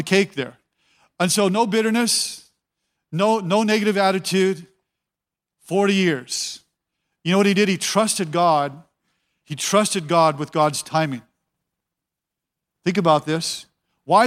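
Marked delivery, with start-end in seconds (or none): none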